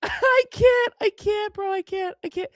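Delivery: high, squeaky words through laughter; helpless laughter. Real, not acted. Streamer overcome by the irony of a tweet, helpless high-pitched laughing. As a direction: barely get it out for laughing.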